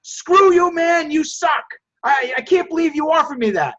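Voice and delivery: role-play of an angry customer yelling at the buyer. Acted. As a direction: play the insulted seller, hammed-up. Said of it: "if they're like".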